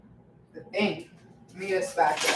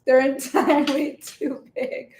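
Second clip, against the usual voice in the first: real laughter breaking through, higher pitch; laughing; 6 semitones higher, 5 dB louder than usual. Real, not acted. Streamer picturing a viewer's blunt comment, laughing. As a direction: crack up while saying it, voice climbing. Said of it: "I'm just laughing 'cause I'm".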